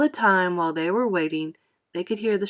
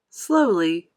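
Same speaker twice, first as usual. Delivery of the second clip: ordinary delivery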